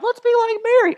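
very high mock-eager voice; squeaky imitation of everyone; gushing imitation. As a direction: parody the admiring crowd way up high. Mocks what everyone says.

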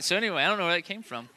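usual speaking voice

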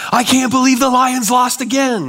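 high mock shout about the Lions; all-caps yelling. Shown as a demonstration. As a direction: read it like a text in all caps. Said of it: "you're yelling all the time".

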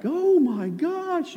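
very high, slow "Oh my gosh"; mock amazement. Preacher mocks gawking onlookers, high and amazed. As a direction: gasp it out in wide-eyed awe. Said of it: "people weren't like".

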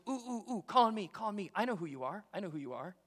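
mock-eager, high-pitched pleading that eases off; high, urgent bid for attention, settling by the end; over-eager volunteer. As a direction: play the keen pupil begging to be picked. Acts out over-eager Peter volunteering.

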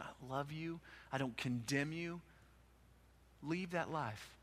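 soft, tender reassurance; Jesus gently forgiving the woman, soft and tender; tender and gentle. Retold or imitated. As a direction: speak it softly, like forgiving a frightened friend. Jesus's loving words, term of affection.

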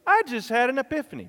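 loud, high, quick exclamation; excited. Shown as a demonstration. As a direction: blurt it out thrilled. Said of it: "next time you can go".

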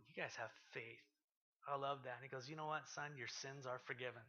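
soft, tender and kind; hushed, loving reassurance; gentle Jesus. Retold or imitated. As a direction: speak it softly to the paralyzed man. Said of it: "Jesus just looking at these guys and going".

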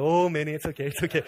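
mock-hurried reassurance breaking into laughter; comic retold comforting with a laugh; amused soothing. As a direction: hush a child, starting to laugh. Father soothes waking child, laughing.

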